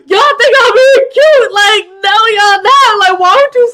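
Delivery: high, loud, laughing disbelief; incredulous outburst. Real, not acted. Viewer mocking a couple trying to be cute, loud and high. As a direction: call them out, half laughing.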